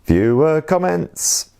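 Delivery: high, drawn-out title call; jingle-style segment title. Pretend. Host introducing the viewer comments segment, high drawn-out jingle style. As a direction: echo the segment name high and slow, like a jingle.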